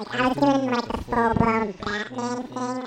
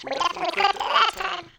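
pitched way up, squeaky; helium voice; 9 semitones higher, 5 dB louder, faster than usual. Pretend. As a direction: chipmunk voice through the effect. Pitch effect on voice.

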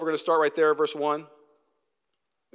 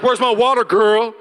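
louder, higher bossy call; mock demanding; 5 semitones higher, 8 dB louder than usual. Husband demanding water from wife, loud bossy call. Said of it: "Being like".

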